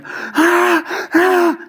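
high, imitating wail of a sobbing man; imitated crying wail. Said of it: "The guy starts screaming and crying and shouting".

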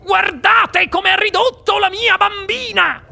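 high-pitched outraged shout; yelling mother. A narrator's reading. Mother shows everyone her ruined daughter, yelling. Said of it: "urlò la signora Beauregard".

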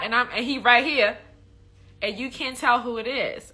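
scolding rebuke, pitch climbing as it goes; acted-out exasperated reprimand; Jesus. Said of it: "what did Jesus tell the Pharisees".

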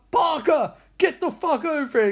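high, acted holler; mock commanding shout; urgent calling out. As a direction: call a man over urgently. Lieutenant calling his officer over.

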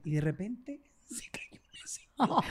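slow, whispered punchline; hushed reveal. Real, not acted. Storyteller revealing to friends the lady fell, hushed and slow. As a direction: drop to a slow, suspenseful whisper.

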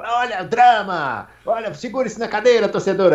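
Galvão. Retold or imitated. Guest retelling the China GP broadcast, mock dramatic commentary. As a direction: mimic a commentator dramatizing a late chase for the lead. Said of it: "O Galvão".

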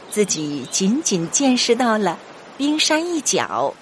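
plain reading voice

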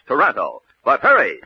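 normal talking voice

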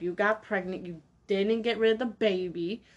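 normal talking voice

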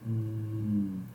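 soft, low, dreamy food craving; Homer. Retold or imitated. Spirit hears of ham for widow, soft drooling murmur. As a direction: savour it with a long low mm.

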